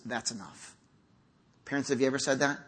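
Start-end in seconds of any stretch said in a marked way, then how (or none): 0.1-0.7 very low, hushed finality; quiet firm limit. Acted. God calling time on his people, quietly firm. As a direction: lay it down softly, low. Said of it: "times when God says".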